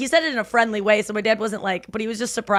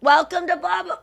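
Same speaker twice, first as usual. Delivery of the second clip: higher, louder forced-cheer greeting; mock staff enthusiasm; 10 semitones higher, 3 dB louder than usual. Staff forced to greet customers, mock cheery welcome. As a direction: pump it up like a chain store clerk. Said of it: "The enthusiasm of".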